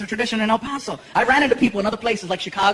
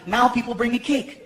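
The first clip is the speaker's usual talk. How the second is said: ordinary delivery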